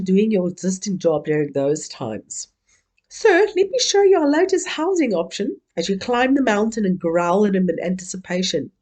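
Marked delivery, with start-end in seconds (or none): 3.1-5.6 eager estate agent voice, pitched much higher; salesy pitch. Acted. Cave-age estate agent to a client, a higher salesy pitch. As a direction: sell the cave with a sugary sales lilt.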